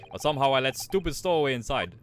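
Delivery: put-on proud guard in a Russian accent. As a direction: boast about first class in a Russian accent.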